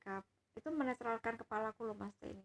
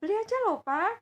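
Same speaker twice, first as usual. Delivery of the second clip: put-on much higher and louder, a small girl begging; little child voice; 9 semitones higher, 12 dB louder than usual. Granddaughter eagerly tells grandpa to just buy. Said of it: "Anak kecil kan ngomongnya gitu ya".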